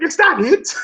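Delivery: high, squeaky unimpressed question; mock disbelief. Law's crew to Luffy, high mock disbelief. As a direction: act let down and incredulous.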